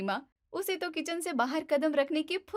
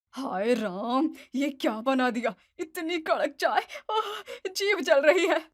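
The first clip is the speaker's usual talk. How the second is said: burnt tongue; 3 dB louder than usual. Acted. Mother-in-law tasting too-strong tea, mock pained cry. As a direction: yelp as the hot strong tea burns.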